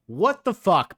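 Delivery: real exasperated outburst; outraged. Real, not acted. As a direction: blurt it out in disbelief. Outraged reaction to the book.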